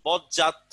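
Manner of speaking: বদজাত said as বজ্জাত, the দ merged into জ; assimilated pronunciation. Shown as a demonstration. Teacher showing how বদজাত is spoken. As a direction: run দ into জ in বদজাত. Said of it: "আমরা এটাকে উচ্চারণ করি কি".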